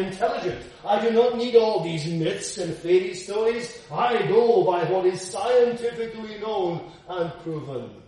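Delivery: loud, high mocking voice of a know-it-all; hammed-up scornful boast; mock sceptic's boast. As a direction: puff up like a smug sceptic.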